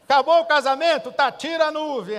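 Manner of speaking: God loudly giving in to the woman's prayer; exasperated God shouting. Retold or imitated. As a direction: bellow it like God giving in, fed up. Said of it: "Deus ouve aquela mulher e fala assim".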